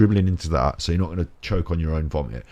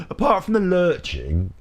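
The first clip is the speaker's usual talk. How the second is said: high and slow; 11 semitones higher than usual. Shown as a demonstration.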